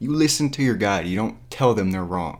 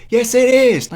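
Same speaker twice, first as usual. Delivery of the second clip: loud, high-pitched retort; exasperated yell; 11 dB louder, slower than usual. Acted. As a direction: shout her denial down. Yells back at the character.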